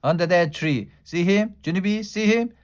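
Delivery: high, loud, bouncy pointing; very excited. A narrator's reading. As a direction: jump up and down pointing him out. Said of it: "very excited".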